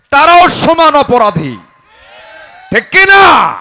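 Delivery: high, loud accusing cry; full-force sermon climax; preaching shout. Acted. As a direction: demand the crowd's agreement.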